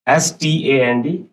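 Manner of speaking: ordinary talk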